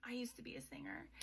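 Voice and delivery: quick soft whisper; whispered aside. Retold or imitated. Lorith admitting to Saris he used to sing, quick whisper. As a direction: hush it like a confession.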